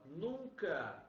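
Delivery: nunca drawn out slowly and stressed; teacher stressing nunca; slow emphatic nunca. Shown as a demonstration. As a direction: lean hard on nunca, slowly. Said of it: "detalhe, por favor".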